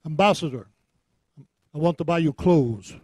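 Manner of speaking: loud, rough attempt at a Ghanaian accent; Texas-tinged Ghanaian accent. Ugandan partner phones the preacher offering clothes, in imperfect Ghanaian accent. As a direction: imitate a Ghanaian accent with some Texas left in. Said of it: "Sorry about my Texas, uh, Ghanaian accent".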